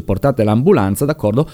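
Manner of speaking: ordinary delivery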